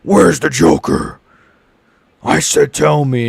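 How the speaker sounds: slow, gruff growled interrogation; Arkham Batman impression. Mocking a beaten fighter as Batman interrogating. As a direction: lean in and demand like Batman at a villain. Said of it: "beating him up like Arkham Batman".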